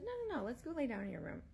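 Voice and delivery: high, quick no-no-no coaxing; insistent coaxing. Retold or imitated. Babysitter brushing off the crying baby talk, high and fast. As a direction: gently overrule the child, hurrying along. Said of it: "And I said".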